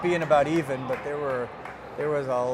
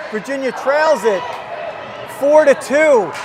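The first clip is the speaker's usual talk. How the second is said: raised, punchy live hockey call; excited play-by-play; 7 semitones higher, 9 dB louder than usual. Real, not acted. Commentator calls a late power play for viewers, excited play-by-play. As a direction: drive the action along with high, loud energy.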